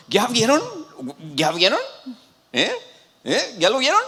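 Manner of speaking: high, loud, eager questions; repeated excited asking, voice jumping up; acted excitement. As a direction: play a thrilled prophet prodding the crowd. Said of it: "este, emocionado".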